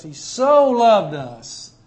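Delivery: slow, emphatic "so" lifted above the rest; stress on so. Shown as a demonstration. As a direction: lean hard and long on the word "so". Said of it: "most emphatic and expressive word in all".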